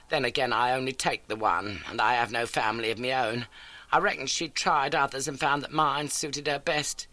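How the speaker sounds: a lightly voiced elderly landlady; homely, eager old lodging-house keeper. A narrator's reading. Landlady greets Holmes, lightly voiced homely.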